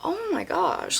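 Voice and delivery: high, soft mock dismay; shocked inner voice. Host in shock at being yelled at, hushed. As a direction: think it to yourself, high and hushed. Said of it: "in my head".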